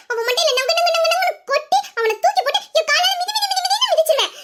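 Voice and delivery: high, escalating bragging threat; boastful threat. Acted. Boasting how she'd beat him up. (வீராப்பா மிரட்டும் குரல்)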